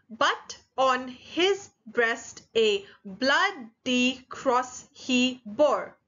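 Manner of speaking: very slow, each syllable set apart; counting out syllables. Shown as a demonstration. Teacher counts a poem line with students, syllable by syllable. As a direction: read it beat by beat for counting. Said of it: "then just let's count together".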